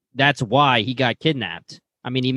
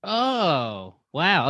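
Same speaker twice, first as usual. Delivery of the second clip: long, softer "oh" starting high and falling; real realization, drawn out and hushed; dawning surprise; 4 semitones higher, 4 dB quieter than usual. Real, not acted. Listener just told it is the bodyguard, dawning surprise.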